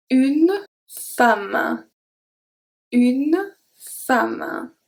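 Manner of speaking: une femme with the E as A, fam, slowly; model pronunciation. Shown as a demonstration. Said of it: "pronounced A".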